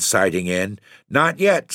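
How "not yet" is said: higher, sharp snap; curt snapping command; snapped. A narrator's reading. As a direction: cut them off sharply. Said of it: "snapped the fisherman".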